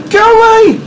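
high yell at an enemy; sharp high-pitched cry. Real, not acted. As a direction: yell it high to drive them off. Yelling at game enemy.

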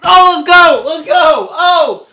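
real ecstatic celebration shout; triumphant shouting. Real, not acted. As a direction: scream with joy after nailing it. Celebrating breaking the record.

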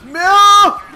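high, loud wail of protest; loud cry of no. Real, not acted. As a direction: cry out at a disaster in the game. Cries out in game.

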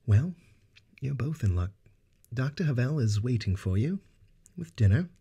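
high, gentle, courteous host voice; gentle Narsil Banks. Acted. Narsil Banks gently tells the guests dinner awaits, high and kind. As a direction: kindly announce dinner to guests. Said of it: "Narsil Banks said gently".

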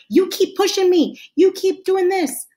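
high, argumentative complaint; accusing. Acted. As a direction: throw it at them like you want to argue. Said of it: "it sounds like an argument".

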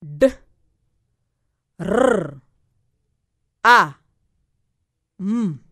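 "D, R, A, M" as separate letter sounds with long pauses, R rolled. Shown as a demonstration. Teacher splits drum into four sound blocks, "D, R, A, M" sounded out singly with rolled R. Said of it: "four sound blocks".

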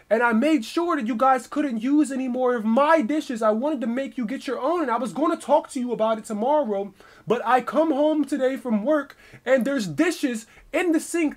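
high, insistent imitation of an accuser; worked up. Roommate accusing him about the dishes, high worked-up rant. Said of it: "He's kinda worked up right now".

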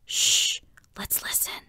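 barely audible whisper; very soft, secretive shushing; hushed whisper. A narrator's reading. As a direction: hush the others, then whisper. Hiding, hushing friends to listen.

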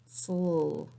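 "sl" said alone as a clipped blend; isolated onset "sl" for children; beginning sound isolated. Shown as a demonstration. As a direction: give just the "sl" onset, no vowel. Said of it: "tell me the beginning sound".

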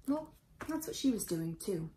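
ordinary delivery, nothing marked

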